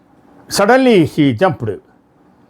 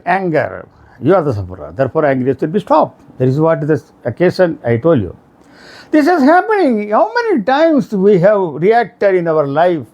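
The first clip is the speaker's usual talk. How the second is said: ordinary talk, nothing marked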